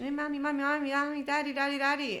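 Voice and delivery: put-on high, childlike calling of mommy and daddy, repeated; three-year-old calling. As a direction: play a toddler tugging for a parent's attention. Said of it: "when a three-year-old, imagine".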